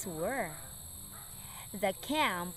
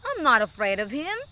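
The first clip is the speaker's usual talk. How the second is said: louder and fast, defiant; brave boast; 4 semitones higher, 10 dB louder, faster than usual. A narrator's reading. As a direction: fling it out boldly. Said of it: "said David".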